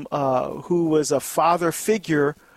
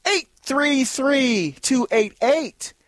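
slow call-in number; 6 semitones higher, slower than usual. Shown as a demonstration. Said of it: "Give us a call".

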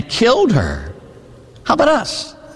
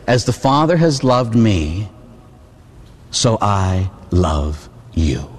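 tender scripture quote; 6 semitones lower, much slower than usual. Acted. Quoting Jesus tenderly.

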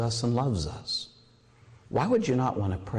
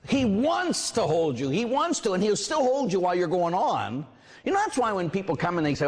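ordinary delivery

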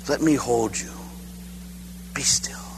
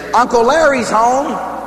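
high, louder, slow announcement; sister calling out; 8 semitones higher, 4 dB louder, slower than usual. Retold or imitated. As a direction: call to the child that uncle's here. Sister calls out his arrival.

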